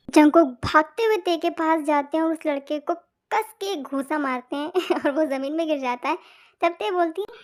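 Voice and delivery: low, soft storytelling voice; low murmured fanfic narration; story narration. A narrator's reading. Narrator describes the fight.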